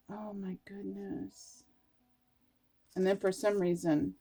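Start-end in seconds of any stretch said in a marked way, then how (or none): 0.1-1.6 soft, slow and much quieter; hushed awe. Real, not acted. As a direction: breathe it out in quiet wonder. Moved after the reading.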